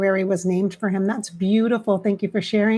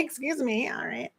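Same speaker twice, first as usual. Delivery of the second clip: ordinary talk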